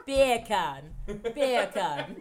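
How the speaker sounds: loud English accent. Shown as a demonstration. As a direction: push the English "Beer can" out loud. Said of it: "Do you hear it?".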